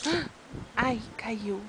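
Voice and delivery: breathy whispered aside; whispered "oops, it fell". Real, not acted. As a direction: hush it under your breath. Soft aside as toddler drops something.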